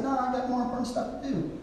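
mock-casual brush-off, sped up; dismissive Mark. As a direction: toss it off like a busy writer. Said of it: "that's how I read it".

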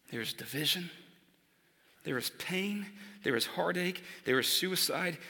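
soft, hushed prayer to God; quiet prayer. Shown as a demonstration. Quiet prayer modelled for church.

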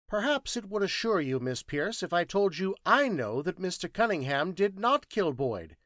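pointed, high-pitched interrogation; Thinking Machine, insistent questioning. Acted.

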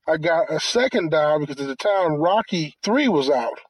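normal talking voice

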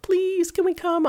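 put-on high, sing-song child's begging; kids pleading. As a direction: wheedle like a little girl. Daughters begging to come along.

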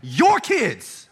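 loud, high, wounded disowning; hurt God's voice. Retold or imitated. God disowning the people to Moses, hurt. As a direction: hand the children off in hurt. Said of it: "You can tell he's been hurt".

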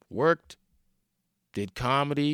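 normal talking voice

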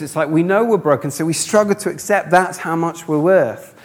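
usual speaking voice

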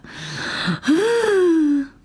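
a big high gasp of sudden realization; mock gasp. Mocking her own realization with a high gasp. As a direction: gasp high as if it just dawned on you.

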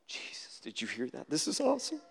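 hushed whisper to Jesus, breaking into a laugh; a soft, secret whisper that ends in laughter; excited whispered prayer. Retold or imitated. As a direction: whisper it gleefully, as if in church. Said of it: "I'm like".

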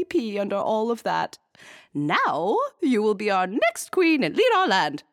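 high, loud queen voice, still gleeful; delighted queen explaining; excited queen. A narrator's reading. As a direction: keep the queen thrilled and regal. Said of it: "the queen squealed with relief and glee".

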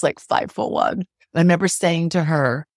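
ordinary delivery

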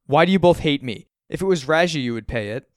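loud, high, accusing outburst; desperate accusation, easing at the end; desperate lashing out. A narrator's reading. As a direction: lash out at both parents. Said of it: "I'm desperate and lashing out".

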